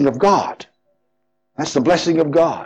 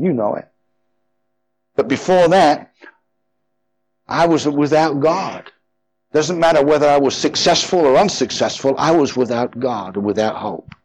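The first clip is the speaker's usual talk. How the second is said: usual speaking voice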